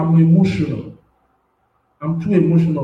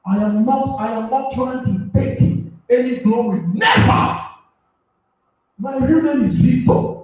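higher, fervent preaching as the spirit takes him; impassioned prophetic preaching; 8 semitones higher than usual. Real, not acted. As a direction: preach it like God is pressing on you. Prophet overcome, preaching at height.

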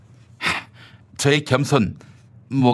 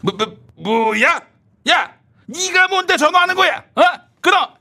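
higher, louder angry dismissal; outraged yell; 11 semitones higher, 3 dB louder than usual. Acted. Minister shocked at upstart caller, higher and louder. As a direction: snap at the upstart to hang up.

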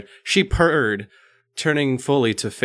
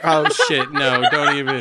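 real laughter, higher pitch; laughing protest; 5 semitones higher, 4 dB louder than usual. Real, not acted. Begging co-host to stop teasing, laughing. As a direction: protest while cracking up.

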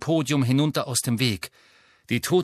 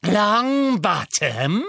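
higher, louder, very slow savouring; Bellatrix relishing; 8 semitones higher, 5 dB louder, much slower than usual. A narrator's reading. Bellatrix recognising Neville, drawn-out cruel glee. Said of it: "wiederholte Bellatrix".

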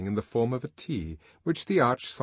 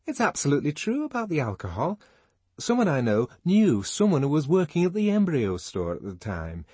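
higher, fast, louder gossipy rush; gossiping Fanny; 6 semitones higher, 5 dB louder than usual. A narrator's reading. Fanny spreading party gossip, fast high gossip. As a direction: rattle off the rumour eagerly. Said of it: "came the voice of Fanny Crown".

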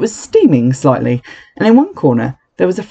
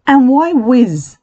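put-on child voice; 10 semitones higher, much slower than usual. A narrator's reading. Large boy asks from front row.

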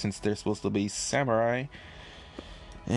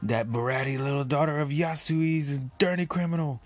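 Orochi ranting; 5 semitones higher than usual. Acted.

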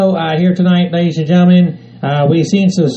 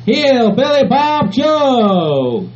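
slow, stretched ring-announcer call; 7 semitones higher, much slower than usual. Acted. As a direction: drag out the name like a ring announcer. Drawn-out guest introduction.

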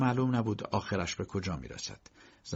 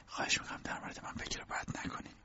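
whispered, pleading softly; 10 dB quieter, faster than usual. A narrator's reading. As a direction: breathe it to him in a whisper. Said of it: "زن آرام به کی گفت".

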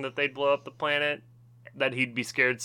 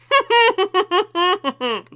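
hummed laugh; 6 dB louder than usual. Shown as a demonstration. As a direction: laugh with mouth closed. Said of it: "Okay, I'll hum it".

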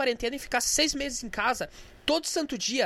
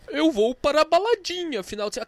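mocking whiny quote; 3 semitones higher, 5 dB louder than usual. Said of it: "Porra, você aí".

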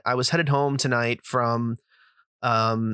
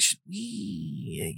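"Should we?" fading much softer to nothing; trailing off; 4 semitones lower, 9 dB quieter than usual. Retold or imitated. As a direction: let "we" die away unfinished. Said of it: "And he just kinda trails off".

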